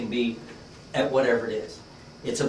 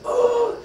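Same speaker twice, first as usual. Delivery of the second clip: high-pitched burst of curiosity; a sudden very high "ooh!" of curiosity; curious "oh"; 5 dB louder than usual. Acted. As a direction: let out a high, eager "oh". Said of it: "where you're just really curious".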